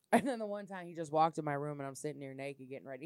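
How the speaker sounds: ordinary talk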